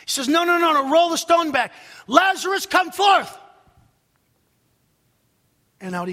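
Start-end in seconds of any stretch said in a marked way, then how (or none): 0.3-1.7 loud, high, urgent order; insistent command. Retold or imitated. Jesus overrules objection at the tomb. 2.0-3.3 loud, high summons; commanding call. Retold or imitated. Voicing Jesus calling the dead out.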